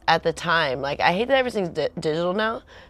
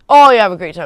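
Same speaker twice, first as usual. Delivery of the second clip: much louder, bright fake-cheery wish; chirpy send-off; 3 semitones higher, 13 dB louder, faster than usual. Girlfriend sending boyfriend off to surf, loud fake cheer. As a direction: sing it out with false cheer.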